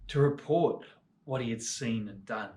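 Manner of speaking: plain reading voice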